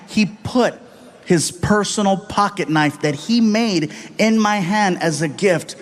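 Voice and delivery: ordinary talk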